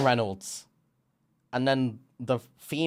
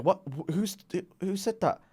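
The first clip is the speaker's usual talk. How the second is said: startled confusion; 7 semitones higher, 3 dB quieter than usual. Retold or imitated. Startled character looks around.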